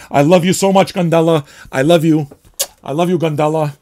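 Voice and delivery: loud, high, gushing declaration; gushing love. Real, not acted. Fan gushing to the record he adores, loud and high. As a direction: shout your love for the record.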